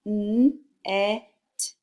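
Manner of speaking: N, E, T as three separate letter sounds; blending sounds. Shown as a demonstration. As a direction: sound out "N, E, T" one sound at a time. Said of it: "Listen as I blend the sounds".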